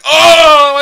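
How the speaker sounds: loud high "oh"; big hammed-up "oh!"; mock climax cry. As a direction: cry out in exaggerated release. Acting out the man finishing.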